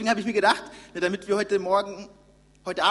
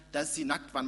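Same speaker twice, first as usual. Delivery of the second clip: normal talking voice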